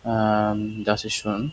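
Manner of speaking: ordinary talk, nothing marked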